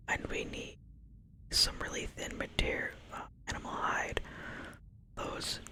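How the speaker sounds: softly whispering the quest text, lightly voicing the quest giver; whispered quest reading. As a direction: read the quest aloud in a hushed whisper. Reads quest giver's lines.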